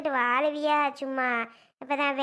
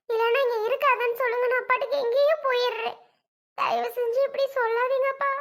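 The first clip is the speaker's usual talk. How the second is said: tearful pleading; 7 semitones higher than usual. Acted. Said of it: "பிள்ளை இவ்வளோ அழுகும்போது".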